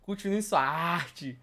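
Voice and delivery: brisk teacher voice, then slowing; a hint of a stern Latin teacher. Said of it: "e fala assim".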